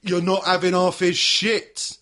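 hammed-up courtroom bluster; pretend lawyer arguing. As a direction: hammer it out like a bullish amateur lawyer.

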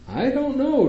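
lightly voiced baffled doctor; puzzled doctor. A narrator's reading. Doctor answers the worried mother, baffled. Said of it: "Doctor Jones says".

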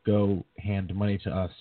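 ordinary talk, nothing marked

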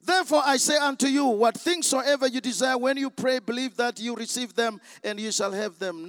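loud, fast proclamation of the verse; forceful scripture reading. Acted. Preacher reads the verse to the congregation, forcefully. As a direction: declaim the scripture at speed.

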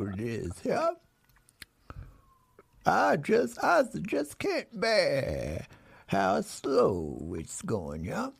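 slow, high drawl with a cowboy twang. Pretend. Host drawls his clue to guests in a slow cowboy voice. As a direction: stretch every word like a lazy cowboy. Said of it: "here's my animals on animals".

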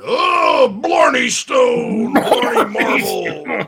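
high, loud, stretched mock Irish wail; hammed-up Irish accent. Radio host mocking a coach with an Irish accent, hammed-up wailing. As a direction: wail it out in an overdone Irish brogue. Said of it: "impersonate Dowell Loggins with an Irish accent".

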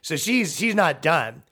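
ordinary delivery

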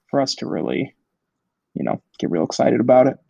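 usual speaking voice

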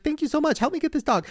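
high, hurried thanks and plea; frantic thanks. Retold or imitated. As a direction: thank them fast, then beg for help. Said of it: "And I was like".

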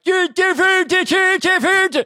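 high, loud, dumb repeated grunt; hammed-up caveman parroting one word; dim Scandinavian caveman. Comic bit mocking his own Scandinavian people, high loud caveman voice. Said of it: "So they're like".

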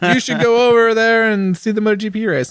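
high-pitched imitation Irish accent; attempted Irish accent. Rider quoting colleague urging him to the race, mock Irish accent. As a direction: put on a rough Irish lilt. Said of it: "you know, Irish accent".

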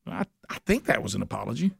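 a quiet doubtful mutter to yourself after a weak apology; puzzled mutter. Retold or imitated. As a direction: mumble it to yourself, unsure. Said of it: "you're like".

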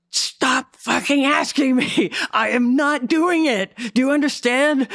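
high, slow, furious telling-off; picture finally snapping at him, slowly and high; imagined angry yell. Pretend. To a pushy co-star, an imagined furious refusal. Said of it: "I would have had to scream at him".

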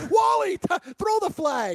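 very high-pitched yell; imitated frantic sideline yell; mock screaming. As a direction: scream it at the coach. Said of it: "They got the guy screaming".

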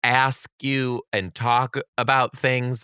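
slowed, drawn-out words; slow drawl. Real, not acted. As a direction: stretch it out deliberately. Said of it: "describing Southern talk".

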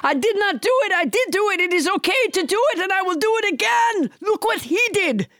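blustering high mock-French accent; French Trump. French Trump defends himself to the hosts, high blustering French accent. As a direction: rattle off denials in hammy high French. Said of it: "with a French accent".

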